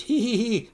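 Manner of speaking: high-pitched scheming hee-hee; Scrooge's cackle. Acted. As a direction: cackle gleefully like a plotting miser. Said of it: "Uncle Scrooge says".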